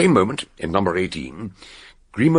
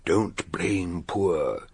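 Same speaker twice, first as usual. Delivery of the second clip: slow, softer voice of a man at death's door; Grimaud's last words to them, faint and slow; dying man; 5 dB quieter, much slower than usual. Retold or imitated. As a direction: let each word drain out of him.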